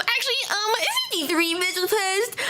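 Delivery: mock the pedantic correction; smug correction. Streamer mimicking the player's smug timing correction. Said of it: "He goes".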